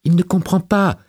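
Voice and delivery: high-pitched, protesting voice; pleading self-excuse. A narrator's reading. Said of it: "la voix de Montgomery se disculpant".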